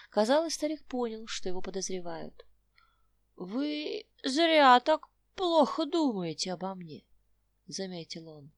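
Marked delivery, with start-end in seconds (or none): 3.3-7.0 put-on old prospector. Old man rebukes suspicion.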